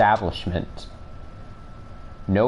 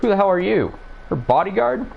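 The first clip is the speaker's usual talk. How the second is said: angry thug; 11 semitones higher than usual. Acted. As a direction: bark a startled challenge. Voicing thug.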